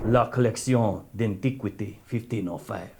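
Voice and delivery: "La Collection d'Antiquité" in an overdone fake French accent, like Inigo Montoya; mock French accent. Shown as a demonstration. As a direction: read the French name with a put-on wannabe French accent. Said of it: "not even like a wannabe French accent".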